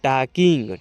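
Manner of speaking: टॉकिंग drawn out as "taa-king"; silent L. Shown as a demonstration. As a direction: say टॉकिंग slowly with no L sound. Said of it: "इसको पढ़िएगा आप".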